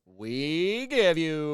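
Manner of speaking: very slow, loud build-up; announcer voice. Acted. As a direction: stretch it like a ring announcer. Announcing the guest.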